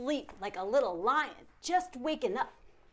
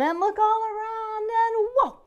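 rising higher and louder to a whoa; excited build; 10 semitones higher, 8 dB louder than usual. Acted. Binocular game, building excitement.

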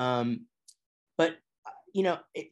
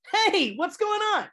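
much higher, slow, friendly greeting voice; Chuck E. Cheese people voice; 4 dB louder than usual. Retold or imitated. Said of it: "what my best friend calls my people voice".

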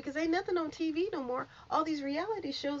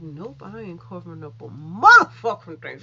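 louder, defiant declaration; imitating a brash gossip host refusing to back down; defiant loud quote; 5 semitones lower, 12 dB louder than usual. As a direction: belt it out like she owns the room. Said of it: "but Tasha's like".

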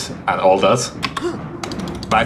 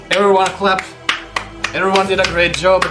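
raised, higher-pitched congratulations to a crowd; rallying applause call; 7 semitones higher than usual. Real, not acted. Player congratulating everyone at the end, rallying applause call.

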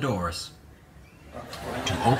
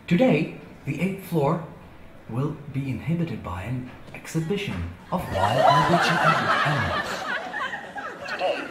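elevator announcer voice; 4 semitones lower, 4 dB quieter than usual. Acted. Hotel elevator tour voice.

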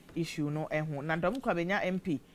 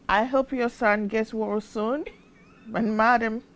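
higher, louder mock American accent; 6 semitones higher, 6 dB louder than usual. Presenter reading a listener's comment on air, mock American accent. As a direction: read it out loudly in a put-on American accent. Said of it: "no say in American accent".

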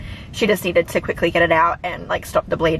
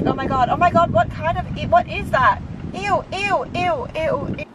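high disgusted squeals; screaming at a spider; 8 semitones higher than usual. Real, not acted. Spider found in car.

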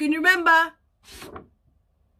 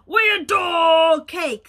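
loud lion; 8 dB louder, slower than usual. Acted. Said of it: "said the lion".

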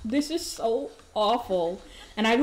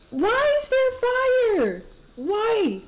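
panicked shock; 12 semitones higher, slower than usual. Real, not acted. Streamer startled as the toilet bursts into flames. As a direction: yelp at the sudden fire.

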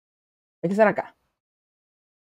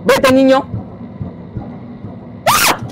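panicked shout; 3 semitones higher, 6 dB louder, slower than usual. Real, not acted. Player shouts at the creepy child, then screams. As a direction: yell him away, then shriek.

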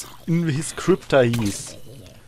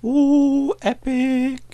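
impressed; 10 semitones higher, much slower than usual. Real, not acted. Reacting to new area.

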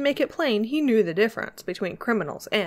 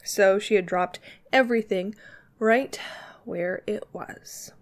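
usual speaking voice

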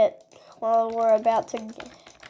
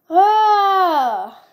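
loud, high crying groan; anguished wail; 6 semitones higher, 6 dB louder than usual. Real, not acted. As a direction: wail out in defeat. Laments losing the game.

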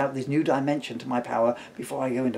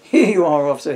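loud, beaming hand-over; loud, cheerful and smiling; 4 semitones higher, 10 dB louder than usual. Acted. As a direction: beam as you reappear. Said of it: "he smiled, reappearing".

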